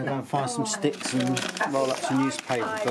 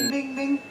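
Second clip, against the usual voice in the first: high bell imitation; 12 semitones higher, 3 dB louder than usual. Mimicking a notification bell.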